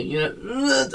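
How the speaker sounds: high-pitched mock whine; whiny singer imitation. Friend attempting the Kings of Leon singer's voice, whiny. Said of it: "you know, whiny".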